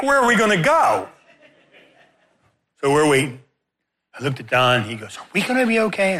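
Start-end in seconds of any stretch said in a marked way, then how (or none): 5.2-6.2 nervous friend. Retold or imitated.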